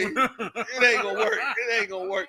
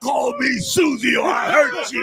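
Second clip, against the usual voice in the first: mock threat; 6 semitones higher, 6 dB louder than usual. Two hosts riff on the customer.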